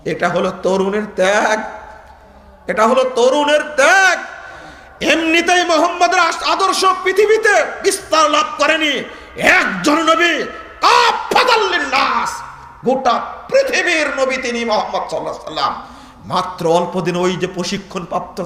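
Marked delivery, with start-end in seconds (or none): 2.7-4.2 higher, more forceful, climbing to ত্যাগ; emphatic proclamation. Real, not acted. Same line repeated with rising force. 5.0-15.9 high, forceful sermon delivery; preaching at full force. Acted.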